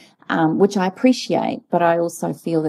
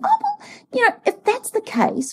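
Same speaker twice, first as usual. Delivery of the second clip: squeaky, much higher voice from the shock; high-pitched voice. Retold or imitated. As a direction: jump way up in pitch, taken aback. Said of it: "my high-pitched voice".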